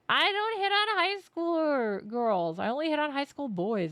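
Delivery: high, loud and slow mock protest; joking protest. Streamer joking about the teacher, mock indignant. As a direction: ham up the indignant denial.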